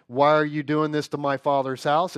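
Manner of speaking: normal talking voice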